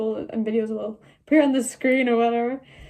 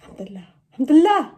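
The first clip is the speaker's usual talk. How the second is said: relieved; 3 semitones higher, 7 dB louder, much faster than usual. Retold or imitated. Relief leaving the dungeon.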